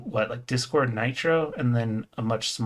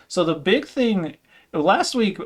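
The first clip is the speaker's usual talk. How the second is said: usual speaking voice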